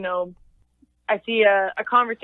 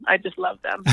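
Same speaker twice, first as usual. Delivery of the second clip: normal talking voice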